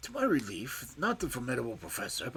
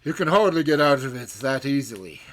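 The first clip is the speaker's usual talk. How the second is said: loud, furious threat; 12 dB louder than usual. A narrator's reading. Old professor raging at Holmes and Watson, furious shout. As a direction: bellow it in furious passion. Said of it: "shook his two hands at us in furious passion".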